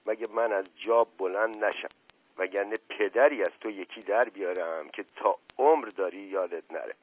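usual speaking voice

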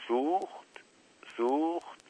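soft, high rising question; wondering echo. A narrator's reading. Uncle Napoleon echoing his brother's news, wondering. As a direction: repeat the word softly, pitch climbing.

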